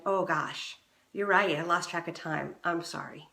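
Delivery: soft, sincere apology; calm responsive apology. Shown as a demonstration. Wife apologizes calmly to husband, soft and sincere. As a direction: own it gently. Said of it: "that calm, responsive".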